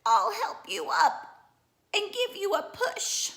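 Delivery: high, loud frog voice; croaky frog. Acted. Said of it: "and croaks".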